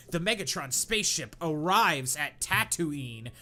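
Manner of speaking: normal talking voice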